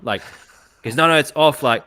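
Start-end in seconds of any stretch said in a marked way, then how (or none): 1.0-1.6 sharper, louder insisting; mock raised voice of an insistent partner; insistent retold quote. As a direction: push the words out, raised and high.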